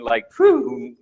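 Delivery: sudden high exhale of glee; quick delighted whoosh; excited whew. Retold or imitated. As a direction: let the rush burst out. Mock exhilaration at peppermint rush.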